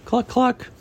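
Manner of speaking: high-pitched spoken clucks; chicken cluck. Acted. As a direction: voice a storybook hen, high. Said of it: "Chicken pulls out money".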